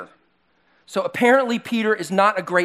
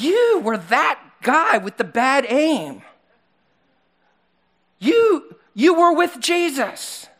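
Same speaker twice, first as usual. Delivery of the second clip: accusing; 7 semitones higher, 3 dB louder, slower than usual. Retold or imitated. Relative accusing Peter.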